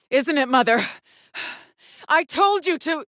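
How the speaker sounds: high, loud accusation, breaking off abruptly; angry outburst. Acted. Daughter loudly accuses mother who would not leave, angry outburst. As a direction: accuse loudly, voice pitched right up. Confronts mother, second take, cut off.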